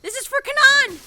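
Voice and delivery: shouted threat. Acted. Character fires at someone.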